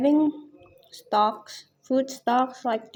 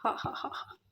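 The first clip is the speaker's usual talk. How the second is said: breathy puffs around a hot bite; mouth full of hot food; 13 dB quieter, faster than usual. Real, not acted. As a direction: blow through a scalding mouthful. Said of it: "that's so hot".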